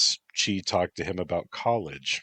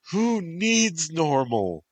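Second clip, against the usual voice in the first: much higher, slow, sobbed; sobbing; 11 semitones higher, 5 dB louder, slower than usual. Acted. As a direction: sob the question out. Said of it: "she sobbed".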